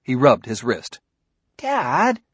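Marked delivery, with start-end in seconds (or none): 1.6-2.2 put-on high, aggrieved boy's voice; a hurt son's complaint to his dad; grieved tones. A narrator's reading. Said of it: "he said in grieved tones".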